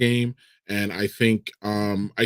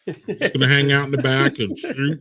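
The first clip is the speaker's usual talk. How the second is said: normal talking voice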